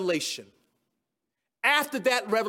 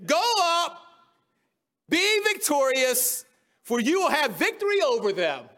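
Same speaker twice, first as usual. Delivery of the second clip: higher, laughing mock encouragement; sarcastic; 6 semitones higher than usual. Micaiah mocking the king before two thrones, sarcastic. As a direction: egg the king on with a sneer. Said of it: "Micaiah said sarcastically".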